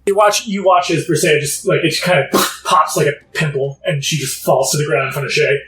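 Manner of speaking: ordinary talk, nothing marked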